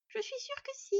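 put-on very high little-girl insistence; squeaky, confident fox cub; childlike assurance. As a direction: insist like a sure-of-herself small child. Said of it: "persista Scad avec l'assurance des plus jeunes".

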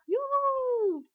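long, high, loud whoop; jubilant whoop. Acted. Boy thrilled with his magic sword, whooping. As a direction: let out a drawn-out cheer.

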